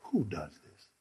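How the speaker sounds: low and soft, pulling the crowd in; hushed awe. Acted. Preacher hushing the church, low soft awe. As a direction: drop down to a hush.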